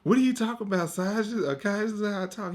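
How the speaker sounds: imitating a boy insisting in a high cute voice; high-pitched, cutesy mock protest; put-on cute voice. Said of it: "trying to make your voice cute".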